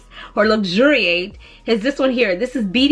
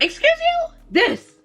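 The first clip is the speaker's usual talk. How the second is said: much higher, offended "excuse you"; indignant retort; 13 semitones higher than usual. Acted. To someone who called her perfume basic, indignant. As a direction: fire back, scandalized.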